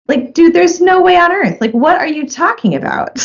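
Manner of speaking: high-pitched retold disbelief; incredulous retort. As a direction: react in exasperated disbelief, pitch jumping up. Retold disbelief at author's claim.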